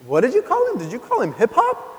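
high, squeaky disbelieving question; a little boy mishearing and asking in shock; mock childlike astonishment; surprised kid. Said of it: "And Zach was like".

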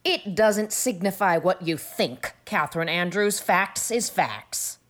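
slow, scornful dismissal; contemptuous. A narrator's reading. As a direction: lay it down slowly with contempt. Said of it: "expressed the utmost contempt".

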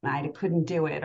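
ordinary talk, nothing marked

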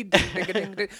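high bell-like dings; ding ding sound effect. Pretend. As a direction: chime it like a game show bell. Game-show style ding.

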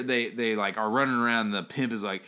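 ordinary talk, nothing marked

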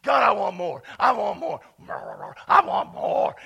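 loud, ravenous begging, building in intensity; hungry pleading. Acted. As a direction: beg like you're starving for it. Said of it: "you get even hungrier for it".

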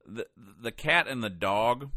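usual speaking voice

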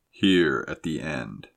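low, slow and solemn. A narrator's reading. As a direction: intone it gravely like a master. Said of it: "said the solemn voice".

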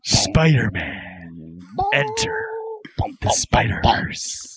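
very slow, breathy title drop; trailer announcer. Pretend. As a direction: whisper the title for drama. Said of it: "you're narrator".

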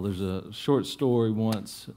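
ordinary talk, nothing marked

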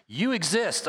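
lightly acted, self-serving false shepherd; a hint of a possessive leader laying claim. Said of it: "a false shepherd says".

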